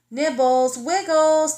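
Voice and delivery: calling for her missing family. Acted. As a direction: call the names out loud. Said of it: "Tickles called".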